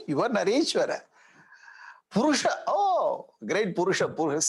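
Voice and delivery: mock-reverent, rising on "oh"; sarcastic praise. Shown as a demonstration. Vali mocking Rama as a great man, sarcastic praise. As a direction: flatter him with a sting. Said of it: "Very nicely he is talking".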